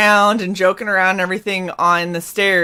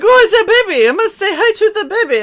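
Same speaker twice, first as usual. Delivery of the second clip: put-on high, loud cooing over a baby; gushing; 12 semitones higher, 9 dB louder, faster than usual. Stranger spotting baby in window, high gushing coo. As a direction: gush like a stranger seeing a baby.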